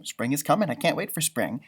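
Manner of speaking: ordinary delivery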